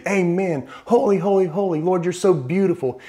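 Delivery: high, bright gushing praise; mock eager worship. Preacher imitating Isaiah joining the praise, high and eager. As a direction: gush like a happy worshipper. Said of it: "join in and say".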